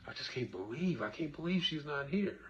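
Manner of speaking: hushed, high, dazed murmur; stunned disbelief. Retold or imitated. Grieving person in denial, hushed stunned disbelief. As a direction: barely get the words out, as if in shock.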